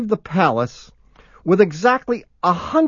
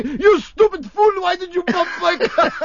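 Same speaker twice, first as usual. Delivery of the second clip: mock French accent; 9 semitones higher than usual. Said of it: "Jump out of the car and say".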